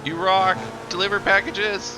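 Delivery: yelling with delight; excited shout. Real, not acted. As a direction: cheer it out loud. Cheering on the delivery truck.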